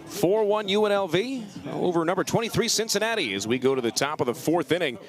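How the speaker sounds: ordinary delivery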